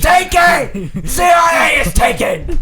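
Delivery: high, drawn-out exasperated scream. Real, not acted. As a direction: scream it at the top of your voice. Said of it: "sorry that we just threw your speakers out".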